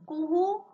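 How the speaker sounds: high loud cuckoo call. Shown as a demonstration. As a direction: sing it like a cuckoo. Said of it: "आपल्याला कुहू".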